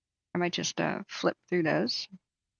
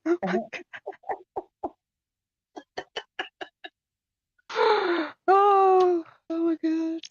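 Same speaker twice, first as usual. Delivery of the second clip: much higher, breaking into laughter; laughing disbelief; 11 semitones higher than usual. Real, not acted. Owner laughing retelling the bird fiasco, much higher. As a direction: laugh through the words.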